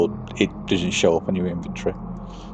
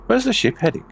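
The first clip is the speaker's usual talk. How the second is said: high acolyte voice; 12 semitones higher, 4 dB louder, faster than usual. Acted. Eager acolyte asking the navigator, voiced high.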